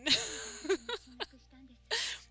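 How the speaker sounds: bright burst of laughter; quick amused laugh. Real, not acted. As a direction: laugh out loud at it. Laughing at the episode.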